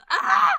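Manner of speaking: high startled yelp; a retold shriek of alarm; mock scared yelp. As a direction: squeak in fright. Scared in the middle of yelling.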